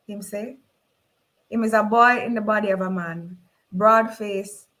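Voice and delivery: usual speaking voice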